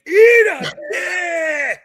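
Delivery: high, loud, drawn-out yelled insult; mock yell. Venting at rude customer, drawn-out mock yell. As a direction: scream it at the customer.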